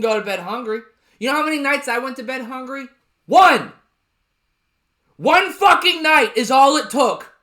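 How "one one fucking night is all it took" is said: emphatic shout. Real, not acted. Ranting about going to bed hungry.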